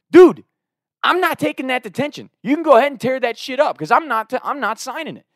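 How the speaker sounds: loud high defiant "Dude"; flatly refusing him, voice up high; angry refusal. Retold or imitated. Student defying the dean, loud retold anger.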